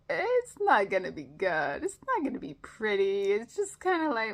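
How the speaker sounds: loud, high, lightly acted caveat; engineer's hedging. Recapping Scotty talking down his rigged fix, loud hedging.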